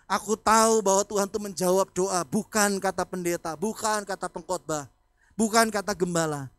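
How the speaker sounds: loud rhythmic preaching refrain; preaching at full force. Acted. Pastor insisting to the congregation, loud repeated refrain. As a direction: hammer each "bukan" in turn.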